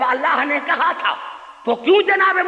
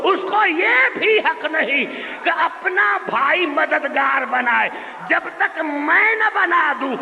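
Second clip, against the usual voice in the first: preaching at full force; 8 semitones higher than usual. Real, not acted. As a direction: hammer the point home to the crowd. Preaching climax. (پورے جوش سے خطابت)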